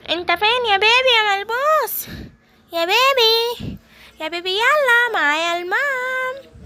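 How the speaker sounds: put-on high coaxing call to the baby, stretched out; sing-song calling. Granny calls the hiding baby, high sing-song coaxing.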